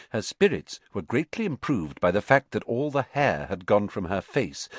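usual speaking voice

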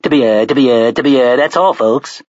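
stuttering cartoon pig voice; Porky Pig. A narrator's reading. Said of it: "electronic version of Porky Pig".

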